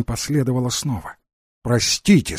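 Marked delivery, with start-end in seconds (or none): none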